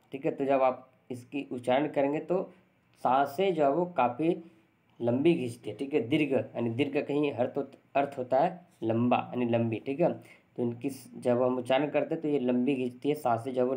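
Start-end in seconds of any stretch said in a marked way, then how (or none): none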